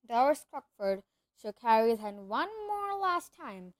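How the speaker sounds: ordinary delivery, nothing marked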